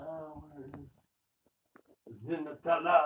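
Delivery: put-on slow, soft French accent. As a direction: ask it in a hammy French accent. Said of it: "Why did you say that in a French accent?".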